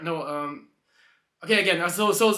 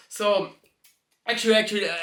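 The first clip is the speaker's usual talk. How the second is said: usual speaking voice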